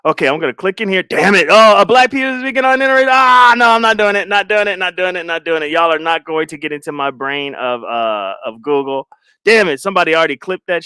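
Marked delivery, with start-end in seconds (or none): 1.0-5.7 loud, high, rushed panic fading to usual voice; alarmed high-pitched protest that tapers off; panicked outburst. Real, not acted. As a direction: recoil loudly, then repeat the refusal, winding down. Shocked by search results on screen.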